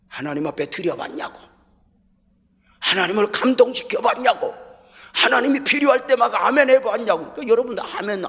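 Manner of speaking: rising to a loud, high preaching shout; retold shouted sermon. Pastor yelling his old sermon at the believers, a loud preaching shout. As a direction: shout the challenge at the congregation. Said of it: "막 고함을 지르면서 설교했어".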